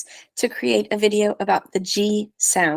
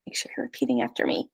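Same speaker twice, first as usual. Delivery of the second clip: whisper; 9 dB quieter, much faster than usual. Real, not acted. Teacher aside during repetition drill.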